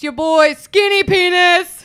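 loud, high, drawn-out announcement; over-the-top self-introduction; mock hype intro. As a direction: shout it like a hype man. Mock show intro shouted.

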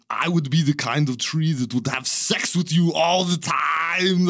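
loud, high, slow mock strongman voice; hammed-up retold contestant answer, ending in a cry; Arnold Schwarzenegger. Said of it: "dubbed him with a really terrible".

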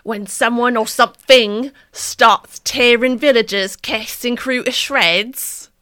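put-on loud, slow, theatrical English accent; high, drawn-out mock-English film blurb. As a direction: declaim it in an exaggerated British accent. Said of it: "Let me channel it back".

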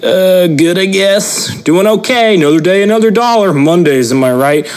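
high, hammed-up office-chat voice; mock a hollow upbeat coworker; chirpy fake office small talk; bored small-talk reply. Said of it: "You then respond something like".

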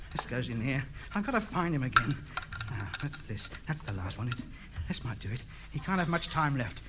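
low, soft absorbed mutter; muttering to himself. Acted. Radio ham alone mending the wires, low worried muttering. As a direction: think aloud under your breath.

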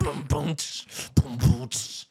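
boomy kick with hissed cymbal; beatbox kicks and cymbal hits; mouthed drum beat. Acted. Backing the movie's rock song, vocal drums.

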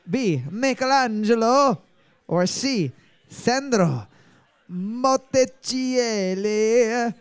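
very slow, hammed-up Italian Botticelli, every syllable drawn out; mock Italian accent. Shown as a demonstration. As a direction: sing out Botticelli in an exaggerated Italian. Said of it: "work you need to do on your German".

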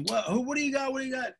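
high, frantic mock yell from afar; retold coach yelling. High-strung coach demanding the call from the official, mock yell. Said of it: "he started yelling".

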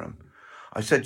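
ordinary talk

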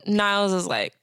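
ordinary talk, nothing marked

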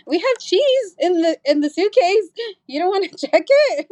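high-pitched, laughing mock offer; incredulous. Traveller to border agents waving her through, high and incredulous. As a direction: pipe up in baffled, giggly disbelief.

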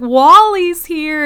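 high, loud, drawn-out mock cheer; imitated proud parents calling out, stretched; excited announcement. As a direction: herald an arrival with hammed-up delight. Acting out parents announcing their son.

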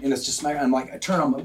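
ordinary delivery

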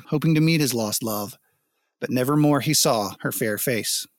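plain reading voice

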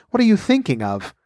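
high-pitched, mildly severe questioning. A narrator's reading. The mouse questioning inattentive Alice, mildly severe. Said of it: "said the mouse to Alice severely".

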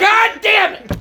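high frustrated shout. Real, not acted. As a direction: yell it out in frustration. Reacts to a bad bean.

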